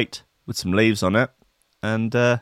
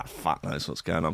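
ordinary delivery

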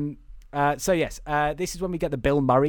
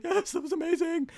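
very high-pitched imitation of an ecstatic scream; mock scream. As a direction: squeal it like a hammed-up comic outburst. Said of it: "he's just screaming, going".

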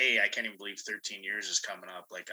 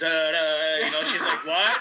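stunned stammer; 4 semitones higher, 7 dB louder than usual. Retold or imitated. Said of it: "And she's".

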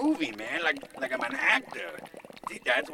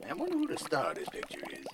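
ordinary delivery

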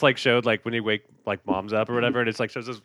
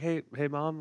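soft wake-up; 6 semitones higher, 6 dB quieter, slower than usual. Retold or imitated. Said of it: "try to be, like, soft".

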